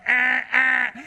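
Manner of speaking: high, loud honking noise; mock car horn. Car stuck behind the devotees honks, high and loud. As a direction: honk like an impatient car behind.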